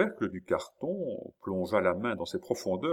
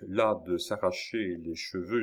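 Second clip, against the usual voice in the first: ordinary delivery, nothing marked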